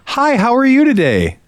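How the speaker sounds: a sing-song cashier's greeting, way up high; chirpy polite greeting. Shown as a demonstration. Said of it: "I don't say".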